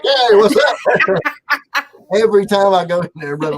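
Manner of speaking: mock cheery hello; imitating Josh. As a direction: chirp it up like a friendly host.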